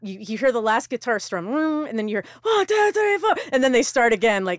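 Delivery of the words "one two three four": high, loud punk count-in; mimicked count-off. Guest imitating a band starting the next song, loud count-off.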